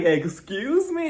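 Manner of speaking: slow, high, drawn-out disbelief; stunned excuse me. Retold or imitated. Recapper reacting to the clinic's name, drawn-out disbelief. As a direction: stretch it out in shock.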